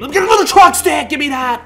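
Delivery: high, loud frantic burst; frantic gamer yell; excited yell. Real, not acted. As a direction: grab it like the game depends on it. Frantic mid-game grab for boost.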